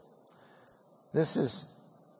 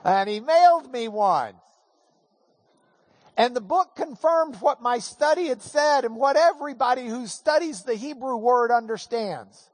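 normal talking voice

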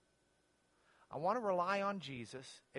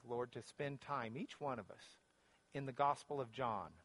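lower-pitched prayer with long pauses; closing prayer; 7 semitones lower, 4 dB quieter than usual. Real, not acted. Pastor leading the group in prayer to the Father, prayerful. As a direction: pray aloud unhurriedly, easing softer toward the amen.

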